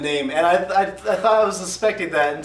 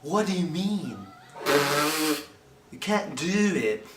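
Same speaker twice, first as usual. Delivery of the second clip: regal, almost noble British accent; slow, stretched-out noble British accent; Nick Cage British accent. Shown as a demonstration. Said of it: "this is my Nick Cage British accent".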